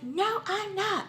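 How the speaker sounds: put-on high, drawn-out childish retort; indignant retold comeback; defiant denial. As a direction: fire back slowly, way up in pitch.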